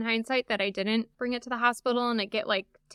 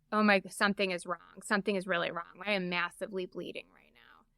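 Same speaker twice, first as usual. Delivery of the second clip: hushed, lowered voice, sinking away; understated alarm, dropping softer and lower; quiet retold alarm; 3 semitones lower, 7 dB quieter than usual. Woman recalling realizing something is wrong, hushed alarm.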